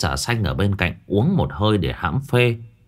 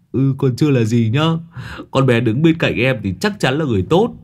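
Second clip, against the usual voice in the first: higher, sly grinning voice; Long grinning; 4 semitones higher than usual. Acted. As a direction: tease with a cheeky grin. Long grins. (Long Đầu Đất cười híp mắt, giọng cao tinh quái)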